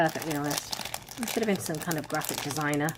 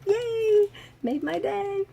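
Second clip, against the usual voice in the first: high-pitched delighted cheer; re-enacted glee; 4 dB louder, much slower than usual. Vlogger acts out her reply to housemate, gleeful. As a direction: squeal it like getting a present. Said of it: "And I'm all".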